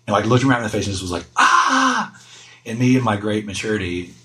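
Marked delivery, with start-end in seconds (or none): 1.4-2.2 high-pitched mock scream of frustration; imitated toddler yell, high but held back; mimicked child's yell. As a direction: let out a kid's exasperated "aah". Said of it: "like, yelled".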